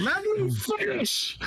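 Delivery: insistent protest. Real, not acted. Insists over interruption.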